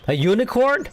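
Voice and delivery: high, astonished squeak; real shock, pitch jumps way up; surprised. Real, not acted. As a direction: genuinely caught off guard by a unicorn. Sudden unicorn appears in game.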